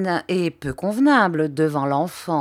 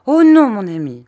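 anguished cry; 9 dB louder than usual. A narrator's reading.

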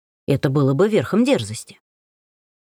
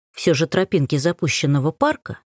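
usual speaking voice

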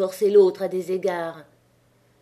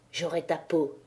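low, much softer menacing threat; through clenched teeth; 3 semitones lower, 6 dB quieter than usual. A narrator's reading. Étienne threatening Chaval, low through clenched teeth. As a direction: grind it out between the teeth. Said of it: "les dents serrées".

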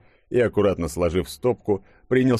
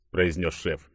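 ordinary delivery

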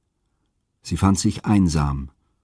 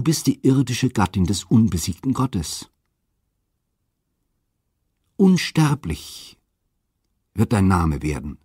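goddess Aphrodite. A narrator's reading. Aphrodite, still smiling, calms the despairing Europa. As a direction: comfort her gently from above.

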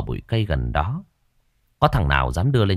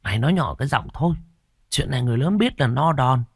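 put-on hushing boy; 5 semitones higher, faster than usual. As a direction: shush your friend before the grown-ups hear. Warns friend to hush.

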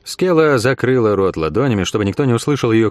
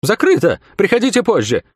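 put-on young commander, much higher and nervous; 10 semitones higher than usual. A narrator's reading. Young commander turning a stranger away, high nervous voice. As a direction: bark it out high and jittery. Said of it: "неожиданно высоким нервным голосом".

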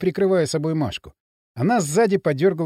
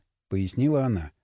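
usual speaking voice

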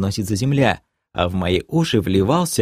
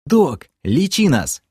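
higher, slow firm order; firm leader's command; 6 semitones higher, slower than usual. A narrator's reading. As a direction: order the medic to work. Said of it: "велел я".

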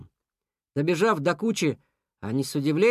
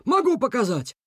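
higher-pitched assured offer; confident; 7 semitones higher than usual. A narrator's reading. Beaver offering to show the rubbish, confident. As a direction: offer proof with certainty. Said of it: "сказал Бобёр уверенно".

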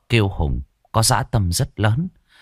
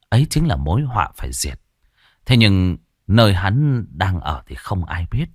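usual speaking voice

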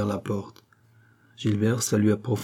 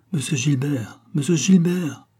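vexed calling, rising on the repeat; 5 semitones higher, 4 dB louder than usual. A narrator's reading. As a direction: call the name twice, increasingly put out. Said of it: "fit le roi très dépité". (très dépité)

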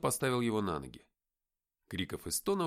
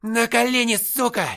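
very loud, high angry order; злобный рык; 12 semitones higher, 13 dB louder than usual. A narrator's reading. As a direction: roar at a prisoner to submit. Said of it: "прорычал сержант". (яростный крик сержанта)

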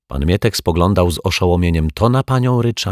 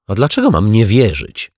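louder, indignant snort; 5 semitones higher, 7 dB louder than usual. A narrator's reading. Neighbour indignantly snorting at the doubting caretaker. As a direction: snort back, offended. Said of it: "prychnęła pani Ryczaj".

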